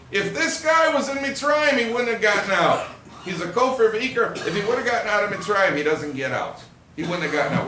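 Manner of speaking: high, loud announcement to the whole table; loud declaration. Acted. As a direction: proclaim it for everyone to hear. Acted declaration to everybody at Seder.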